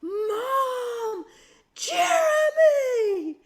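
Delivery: put-on high, very loud crying shout of a little boy; a six-year-old shrieking for his mom, voice cracking; child's panicked scream. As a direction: scream his brother's name from far off in terror. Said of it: "he screamed from the woods".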